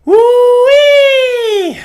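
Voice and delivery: high, loud whoop of release; a wild cheer straight at the mic; excited whoop. Real, not acted. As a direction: let out a big whoop. Shouting out over money stress.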